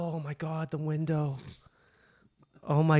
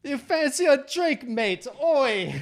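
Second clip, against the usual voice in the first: loud, raised-pitch fake Aussie; mock Australian accent; 8 semitones higher, 6 dB louder, slower than usual. Guy chatting up a girl, loud fake Australian accent. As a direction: ham up an Australian pick-up line. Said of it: "Like the same accent".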